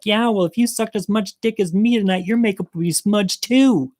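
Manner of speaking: high, sassy clapback; a drag queen telling hecklers off, high and sassy; bitchy comeback. Retold or imitated. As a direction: fire it back high and cheeky.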